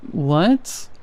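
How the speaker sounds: high drawn-out what. A narrator's reading. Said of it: "a more high-pitched and drawn out".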